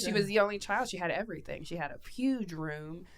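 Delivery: ordinary talk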